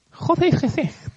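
high, hissing serpent voice; snake voice. Shown as a demonstration. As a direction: slither the words like a snake. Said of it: "Een beetje als een slang praat".

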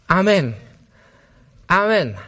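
loud, punched amen, falling; emphatic amen. Real, not acted. Preacher to the congregation after sin is settled, loud declaration.

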